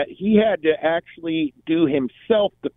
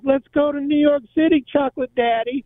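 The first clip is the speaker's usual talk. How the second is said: a playful mock call to her lover; daughter's voice; 11 semitones higher than usual. Daughter emerges, calls to slave.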